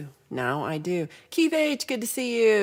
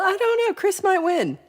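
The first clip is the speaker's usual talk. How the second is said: excited squeal; 13 semitones higher, 6 dB louder than usual. Real, not acted. Excited over band name.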